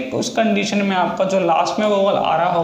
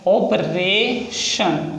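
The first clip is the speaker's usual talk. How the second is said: extremely slowed dictation; very slow stretched word; much slower than usual. Shown as a demonstration. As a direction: pull the word out syllable by syllable. Repeats word while writing.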